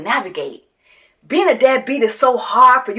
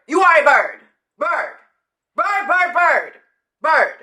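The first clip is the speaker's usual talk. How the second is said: ordinary talk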